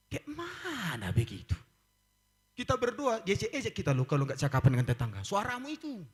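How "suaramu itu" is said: mocking neighbour.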